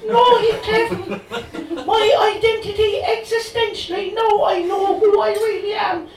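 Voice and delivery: Cathy, high and loud in bad Cork brogue; dodgy Cork accent. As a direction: gush at him in a hammed Cork lilt. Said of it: "delivered in a dodgy Cork accent".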